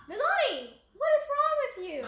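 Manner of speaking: high, exasperated yell at the dog. Real, not acted. Owner yelling at her barking dog, high and exasperated.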